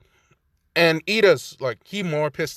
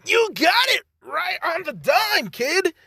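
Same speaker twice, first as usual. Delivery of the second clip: big grinning DJ holler, pitched way up; President Mike shout; slower than usual. Retold or imitated. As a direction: belt it like a hype radio host. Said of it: "President Mike just smiles and saying".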